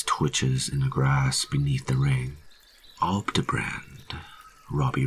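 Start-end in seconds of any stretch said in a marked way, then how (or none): 3.0-4.2 slow, whispery word; hushed repeat. A narrator's reading. Robbie repeating the dead man's word, hushed and slow. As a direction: turn the odd name over under your breath. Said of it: "Robbie murmurs".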